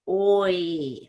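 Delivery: OI gliding from a long O into E; O plus E glide. Shown as a demonstration. Teacher builds the vowel, OI as O into E. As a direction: join O and E slowly in OI. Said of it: "O and then E together".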